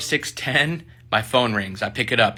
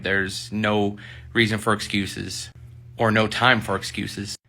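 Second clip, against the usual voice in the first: ordinary talk, nothing marked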